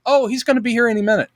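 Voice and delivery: high, fast breezy reply; quick reassuring answer. Retold or imitated. Bryce answering George about her dad, high and quick. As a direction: rattle it off brightly. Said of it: "she's like".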